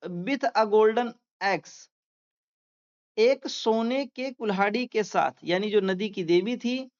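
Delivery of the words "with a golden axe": very slow, word by word dictation; slow classroom reading aloud. Shown as a demonstration. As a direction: read it out slowly for students to follow. Teacher dictating slowly.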